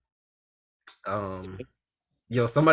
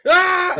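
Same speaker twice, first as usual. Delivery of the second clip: meme scream; 11 dB louder than usual. Retold or imitated.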